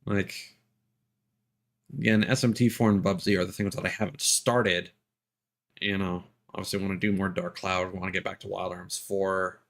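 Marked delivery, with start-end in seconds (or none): none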